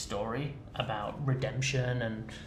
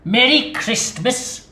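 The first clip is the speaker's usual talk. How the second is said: loud, high mocking anger; scornful Scrooge; 3 semitones higher, 14 dB louder, slower than usual. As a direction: sneer at the very idea.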